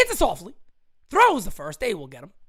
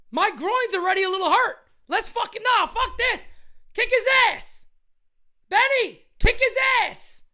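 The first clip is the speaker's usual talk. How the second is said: much higher, loud furious cheering; yelling for a fight; 11 semitones higher, 3 dB louder than usual. Real, not acted. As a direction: scream at him to go fight.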